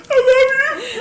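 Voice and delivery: very high, loud gushing cry; swooning damsel. Retold or imitated. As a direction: gush like a rescued damsel in distress. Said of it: "And I was like".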